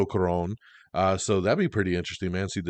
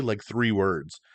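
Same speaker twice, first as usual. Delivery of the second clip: ordinary talk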